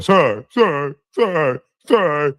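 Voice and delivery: mocking put-on wimpy apology; mock groveling in an altered little voice; weenie-dog apologizing. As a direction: repeat the apology like a cowering weenie. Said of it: "keep apologizing like a weenie dog".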